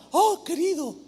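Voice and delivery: high sugary coo of a flatterer; mock-sweet greeting; flattering tone. Preacher imitating Absalom's flattering coo. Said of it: "con ese tono así lisonjero".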